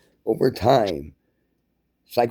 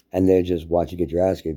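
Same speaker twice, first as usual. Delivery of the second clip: usual speaking voice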